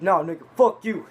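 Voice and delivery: loud mock-angry retort; retold angry outburst. Man squaring up to Eugene before punching him, retold angrily. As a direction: throw it out loud, imitating an aggressor.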